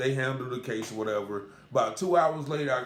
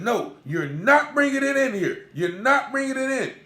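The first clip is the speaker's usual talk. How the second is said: higher, louder mock bailiff yelling; retold holler; 10 semitones higher, 5 dB louder than usual. Bailiff hollering at a man with medical gear, higher and louder. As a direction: bark it like an angry bailiff. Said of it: "you don't have to holler at him like that".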